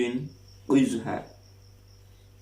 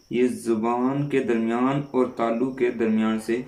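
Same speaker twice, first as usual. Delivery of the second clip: ordinary delivery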